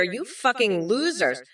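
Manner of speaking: ordinary talk, nothing marked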